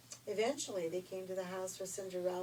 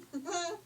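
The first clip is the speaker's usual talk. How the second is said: laugh; 10 semitones higher, 6 dB louder than usual. Real, not acted. Storyteller laughs.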